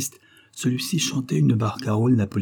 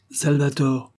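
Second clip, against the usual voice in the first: higher, severe summons of his name; stern voice; 5 semitones higher, slower than usual. A narrator's reading. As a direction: call him out like a stern master. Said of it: "d'une voix sévère". (appel sévère, plus aigu)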